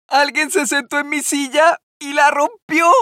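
tiny bear crying out over his broken chair; baby bear's little voice. A narrator's reading. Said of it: "exclamó el osito en su vocecita". (vocecita muy aguda del osito)

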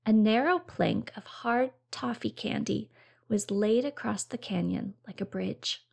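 ordinary delivery

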